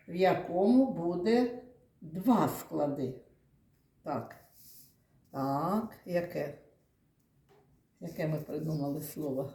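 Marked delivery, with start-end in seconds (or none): none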